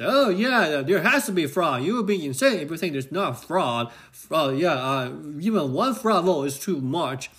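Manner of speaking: a hammed-up, badly done Georgian accent; mock a Georgia rally voter in a clumsy Georgian accent. Vlogger imitating Trump rally voters in a bad Georgian accent. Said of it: "talking in Georgian accent".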